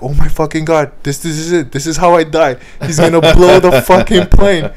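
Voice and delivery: high, loud, frantic panic building up; rising terror, sure the plane's about to blow; freaking out. Retold or imitated. Nervous traveller hearing a cry mid-flight, freaking out loudly. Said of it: "I started to freak out".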